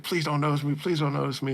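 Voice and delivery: lightly voiced nervous girl; mock nervous pleading. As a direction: beg under your breath. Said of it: "she's like".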